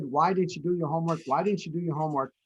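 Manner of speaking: quick, repetitive nagging parent. Retold or imitated. Parent nagging a kid about homework, mildly mimicked, quick. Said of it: "the parent nagged the kid".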